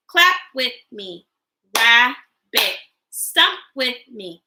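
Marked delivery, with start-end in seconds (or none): 1.8-2.8 "Rabbit" broken into two beats with a clear gap; split into syllables. Shown as a demonstration. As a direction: say "Rabbit" one syllable at a time. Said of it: "Break words into parts with me".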